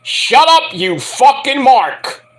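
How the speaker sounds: loud, high-pitched outburst; angry shout. Real, not acted. Podcast host telling off a rival commentator, shouting angrily. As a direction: shout the insult, drawing out the words. Host rants at Vince Russo.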